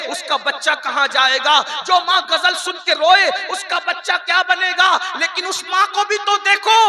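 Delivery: building into loud, high, impassioned preaching; fiery preaching. Acted. Preacher rousing the women of the congregation, loud fiery preaching. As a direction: thunder the rebuke at the congregation. Preacher rising to full-force exhortation.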